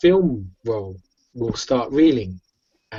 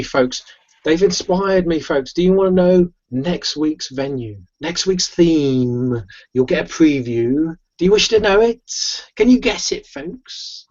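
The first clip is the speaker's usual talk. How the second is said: theatrical host, rising higher toward the end; showman's tease; 4 semitones higher than usual. Acted. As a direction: tease the audience with a coming reveal. Bunny host teasing the crowd.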